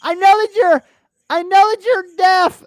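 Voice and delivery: high, loud shouted question; mock flight attendant yelling are you deaf. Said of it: "they're yelling so loud at me".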